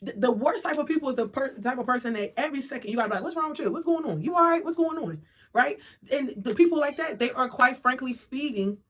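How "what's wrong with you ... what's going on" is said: mock pestering partner.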